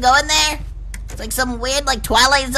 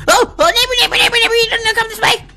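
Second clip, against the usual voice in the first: high, loud alarm at the approaching neighbor; startled cries as the neighbor appears; alarmed high calling; 9 semitones higher, 3 dB louder than usual. Real, not acted. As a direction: yell in sudden fright. Neighbor chasing him.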